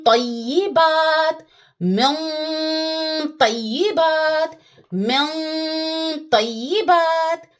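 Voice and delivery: मिन तय्यिबात chanted slowly with a heavy nasal on मिन; full-mouth nasal before तय्यिबात; heavy full-mouth ghunna. Shown as a demonstration. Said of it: "तो इसको आप पढ़िएगा".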